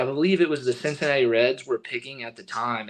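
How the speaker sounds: ordinary talk, nothing marked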